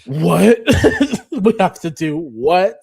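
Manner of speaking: loud, high retold "what?"; shocked disbelief. Retelling shocked reaction to suggestion.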